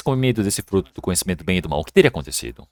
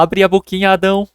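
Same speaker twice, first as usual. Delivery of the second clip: higher, cajoling mock wife; coaxing wife; 8 semitones higher than usual. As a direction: coax him like a little child. Wife coaxes husband, diminutive.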